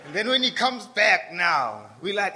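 normal talking voice

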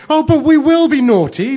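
put-on high, loud childlike protest; pleading wail, very high and loud; despairing. A narrator's reading. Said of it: "they said in despair".

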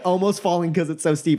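normal talking voice

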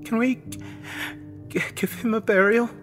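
high, stammering, tearful plea; choked-up girl's halting request; grief-stricken. A narrator's reading. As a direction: stumble on the words through grief. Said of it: "finally came to grips with the reality".

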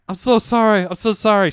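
high, loud, overdone apologizing; hammed-up frantic sorry; repeated apology. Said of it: "And I kept saying".

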